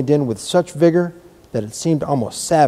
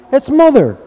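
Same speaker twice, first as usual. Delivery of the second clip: high, loud joyful call; excited cry; 12 semitones higher, 9 dB louder than usual. A narrator's reading. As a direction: shout it out gladly. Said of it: "Dickon said again".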